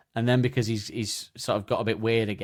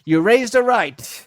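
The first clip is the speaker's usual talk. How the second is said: high, loud, slow voice; mock proud father; 11 semitones higher, 9 dB louder, much slower than usual. Dad praises the man over the phone, high and slow. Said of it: "He goes".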